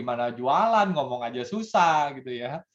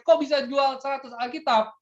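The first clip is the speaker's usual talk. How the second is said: much higher, incredulous question; astonished; 13 semitones higher than usual. Acted. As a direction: sound floored by the number. Shocked salesman quoted. (terkejut dengan nada sangat tinggi)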